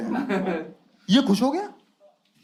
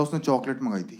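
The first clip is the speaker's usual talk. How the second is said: mock sob; 10 semitones lower, 10 dB quieter than usual. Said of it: "कह रहा".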